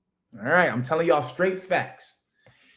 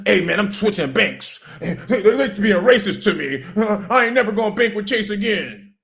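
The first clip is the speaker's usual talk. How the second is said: a touch higher mock complaint; mocking the audience; 5 semitones higher, 3 dB louder than usual. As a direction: imitate a fussy customer lightly. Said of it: "this y'all right here".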